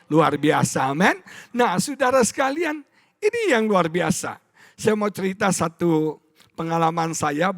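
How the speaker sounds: ordinary delivery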